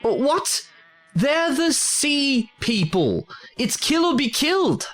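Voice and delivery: pleading that it's kill or be killed; high-pitched outraged disbelief; incredulous protest. Acted. Protests against sparing sea people.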